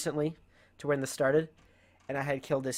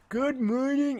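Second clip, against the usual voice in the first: high-pitched mocking imitation; creepy vice principal; 10 semitones higher, slower than usual. Student mocking the vice principal's morning greeting, high put-on voice. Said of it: "he always talked like that".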